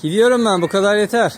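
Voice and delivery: loud and high over the meowing; loud and high, leaving the cats behind; calling out loud. Real, not acted. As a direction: raise your voice above the crying cats.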